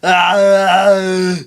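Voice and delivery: put-on slow, loud Austrian accent; Arnold Schwarzenegger voice. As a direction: drawl it like Schwarzenegger. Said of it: "Like Arnold Schwarzenegger".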